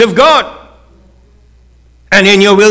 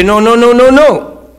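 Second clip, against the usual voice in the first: louder, higher repeated no; protesting centurion; 6 semitones higher, 5 dB louder than usual. Acted. Centurion refusing Jesus' visit, loud protest. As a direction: wave off the offer.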